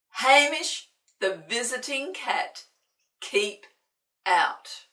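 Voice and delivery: bold, loud reading of the sign; sign read loudly. A narrator's reading. Reading the sign aloud to the children, loudly. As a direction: boom out the warning like a sign.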